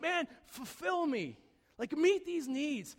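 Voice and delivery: high, slow pleading; high drawn-out plea to the idols; pleading mock prayer. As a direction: beg to be filled, drawn out. Voicing people begging idols.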